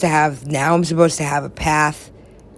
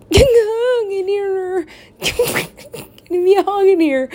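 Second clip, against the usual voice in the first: mock crying; 12 semitones higher than usual. Mock crying plea for hug.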